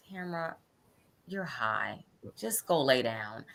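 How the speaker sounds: low, soft, slow reassurance; hushed, unhurried, dropping low; calm husband. Retold or imitated. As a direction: imitate a husband quietly settling his wife. Imitates husband calming her by phone.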